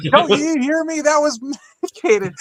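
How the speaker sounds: pressing the point again, half laughing; mock exasperated repeat. Friend repeats warning, exasperated.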